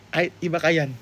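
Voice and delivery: ordinary talk, nothing marked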